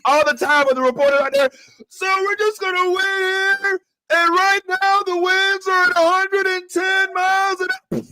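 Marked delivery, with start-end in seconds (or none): 1.9-7.8 high, put-on newscaster voice; mock reporter. Mocking a field reporter standing in the storm, high and put-on. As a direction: play a calm reporter in a hurricane.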